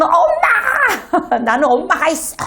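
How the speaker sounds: put-on high, drawn-out child's cry for mom, then a laugh; child calling for mom. Scolded child crying out to mom, high and drawn out. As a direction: play the little kid running to mom.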